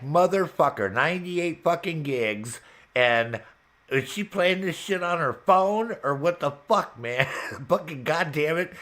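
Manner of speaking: loud, high retold tirade; pissed-off rant. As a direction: replay your outrage at a friend, half laughing. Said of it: "I was so pissed off at you".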